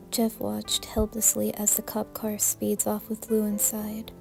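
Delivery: ordinary delivery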